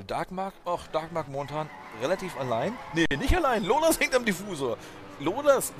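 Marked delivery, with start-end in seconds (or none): 2.9-5.7 raised excited commentary, higher and louder. Real, not acted. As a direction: call it as the chaser appears. Spotting a chase, commentary. (aufgeregter Kommentar)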